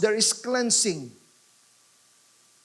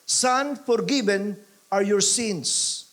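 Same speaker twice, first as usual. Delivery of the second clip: slow, with forgiven hit hardest; stress on forgiven; slower than usual. Shown as a demonstration. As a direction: press forgiven, then let the rest fall. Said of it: "Yung emphasis nandun sa forgiven".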